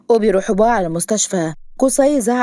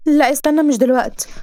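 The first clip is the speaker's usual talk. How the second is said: higher, urgent heroine voice; pitched-up objection; protesting heroine; 7 semitones higher than usual. Acted. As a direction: stop him with a quick protest. Heroine stops him leaving.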